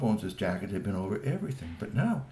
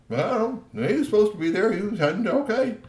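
higher and a bit louder, flustered; flustered character voice; 11 semitones higher, 6 dB louder than usual. Acted. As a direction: stammer it out in a high voice. Character flustered answer in story.

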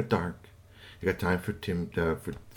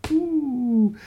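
very high wondering ooh. A narrator's reading. Narrator to children, a high ooh of wonder. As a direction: coo in amazement.

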